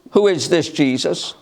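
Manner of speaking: ordinary talk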